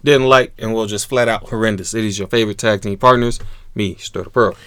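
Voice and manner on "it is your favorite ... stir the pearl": ordinary talk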